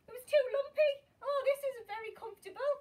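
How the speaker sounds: high, loud grumble of discomfort; exaggerated, high-pitched dismay for children; dismayed complaint. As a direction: complain loudly as Goldilocks on a lumpy bed. Goldilocks tries the lumpy bed.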